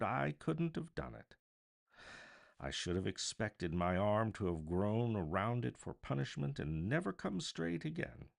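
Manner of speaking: usual speaking voice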